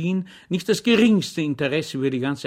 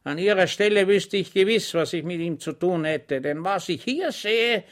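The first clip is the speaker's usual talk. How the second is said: higher, louder contemptuous general; harsh inspecting general; 7 semitones higher, 4 dB louder than usual. Acted. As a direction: sneer at the commander with icy menace. The dreaded general's inspection speech.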